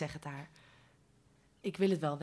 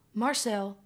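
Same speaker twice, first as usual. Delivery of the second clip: louder falling call; 2 semitones higher, 4 dB louder than usual. Acted. Mo pleading with Marcel.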